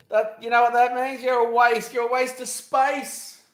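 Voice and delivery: loud, high jeering insult. Real, not acted. Vlogger loudly explains the insult to viewers.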